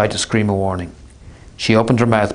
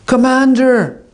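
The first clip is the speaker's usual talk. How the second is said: shout; 5 dB louder, slower than usual. Acted. Holly calls out to Vinyaya.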